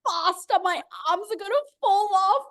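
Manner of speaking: high, pleading complaint; complaining helper. Retold or imitated. The helper holding the iPad begs her to hurry, in a high complaining voice. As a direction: whine that your arms are giving out. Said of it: "She's like".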